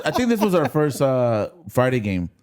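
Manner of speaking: ordinary talk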